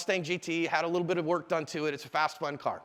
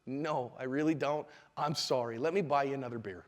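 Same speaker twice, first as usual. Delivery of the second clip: sincere apology; 5 semitones lower, 5 dB quieter than usual. Acted. Man apologizing to stay out of a bar fight, sincere. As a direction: back down gently and calmly.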